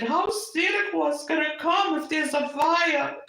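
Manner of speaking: put-on high, slow, worried child's wail; little child crying out. Small child at Christmas fearing for Santa, wailing. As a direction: plead like a scared toddler about Santa. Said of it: "and I said".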